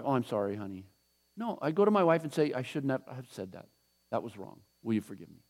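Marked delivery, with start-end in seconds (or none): 0.0-0.8 fake apology. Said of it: "That's an apology". 2.5-5.4 quiet, earnest apology; sincere apology. Retold or imitated. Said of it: "That's an apology".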